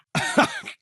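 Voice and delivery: a sudden high burst of laughter; a quick amused laugh; hearty laugh. Acted. Man laughs at a joke.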